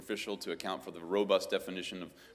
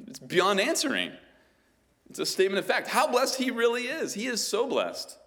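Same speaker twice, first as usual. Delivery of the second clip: loud, driving pulpit voice; booming sermon delivery; forceful preaching; 7 semitones higher, 10 dB louder than usual. Real, not acted.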